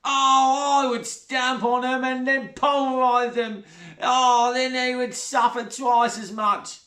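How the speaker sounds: high, slow, gloating voice; Sheila, gleeful stepmother. A narrator's reading. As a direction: savour the nastiness. Sheila relishes cruelty.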